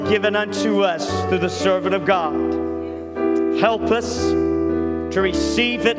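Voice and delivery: ordinary talk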